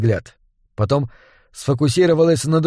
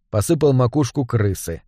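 usual speaking voice

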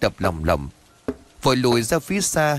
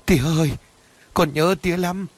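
sobbing daughter; 6 semitones higher than usual. A narrator's reading. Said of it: "bật khóc nức nở".

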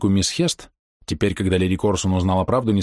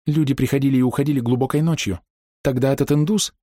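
higher, agitated revelation; feverish; 6 semitones higher than usual. A narrator's reading. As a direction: press on feverishly. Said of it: "лихорадочно продолжала Ида". (лихорадочно, взволнованно)

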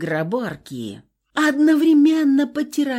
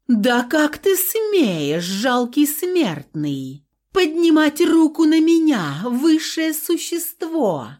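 outraged haughty goddess; 7 semitones higher than usual. A narrator's reading. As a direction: lord it over a mere mortal.

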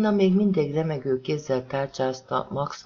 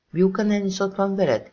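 magasabb, hangosabb, ingerült kérdés; ingerülten csattan fel; 6 semitones higher, 4 dB louder than usual. A narrator's reading. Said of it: "kérdezte Max ingerülten". (ingerült, felcsattanó kérdés)

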